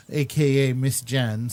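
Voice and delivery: normal talking voice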